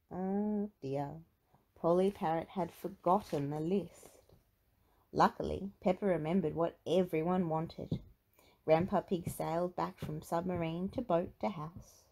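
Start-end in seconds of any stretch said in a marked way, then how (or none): none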